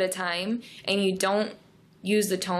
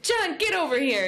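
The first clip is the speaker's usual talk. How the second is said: high, sharp scold falling on John; mock scolding; 9 semitones higher than usual. Shown as a demonstration. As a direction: order John over, half playful. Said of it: "you're really scolding".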